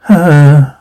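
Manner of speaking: audible acted sigh. Narrator acts the huntsman, sighing. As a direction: let out a full sigh. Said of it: "Huntsman sighs".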